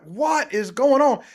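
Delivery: loud, slow, high disbelief; baffled outcry. Retold or imitated. To cohost about delivery drones, retelling his loud baffled reaction. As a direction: drag out the bewildered question. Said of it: "I'm like".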